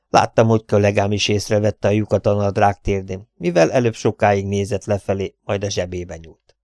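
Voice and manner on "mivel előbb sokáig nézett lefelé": usual speaking voice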